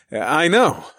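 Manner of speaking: chuckle, then concede it; amused laugh into the words; chuckling. A narrator's reading. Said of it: "I chuckled".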